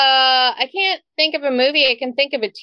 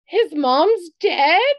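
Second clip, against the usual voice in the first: put-on shocked child; 6 semitones higher, much slower than usual. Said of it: "she's like".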